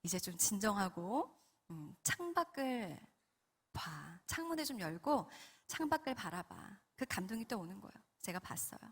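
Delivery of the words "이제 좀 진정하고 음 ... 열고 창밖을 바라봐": soft, measured divine voice; calm someone down softly, with pauses; quiet, soothing voice of God; Holy Spirit. Retold or imitated. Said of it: "성령님께서 또 이런 감동을 주십니다".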